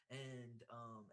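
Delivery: usual speaking voice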